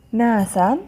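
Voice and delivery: ناسا read with a final -an; tanween fath. Shown as a demonstration. Teacher reading out the word list, tanween fath on ناسا. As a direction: sound out ناسا as "naasan". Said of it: "تنوين فتح".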